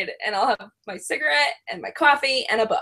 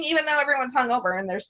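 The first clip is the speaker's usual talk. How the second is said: ordinary talk, nothing marked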